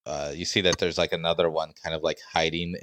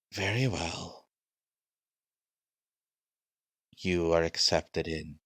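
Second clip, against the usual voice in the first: slow, solemn guard voice; measured gatekeeper in character; creepy guard; 3 dB quieter, slower than usual. Acted. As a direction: grant entry in drawn-out words. Game master voices the gate guard.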